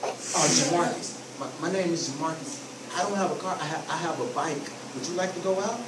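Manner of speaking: low, soft, halting and unsure; nervous. Shown as a demonstration. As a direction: stammer out the question shyly. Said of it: "and I'm nervous".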